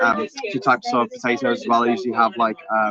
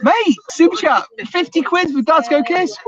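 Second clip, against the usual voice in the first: thrilled shout; 4 dB louder than usual. Real, not acted. Host announces fifty quid super chat, thrilled. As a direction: announce it in amazed delight, ending in a whoop. Big donation comes in.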